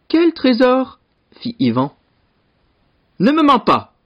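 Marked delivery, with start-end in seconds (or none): 0.1-1.0 high, innocent-sounding question; high-voiced mock puzzlement; feigned ignorance. Brother feigns ignorance to the lord. 3.2-3.9 high, emphatic lordly command; storybook lord's high-pitched rebuke; angry warning. Acted. Angry lord accuses the brother.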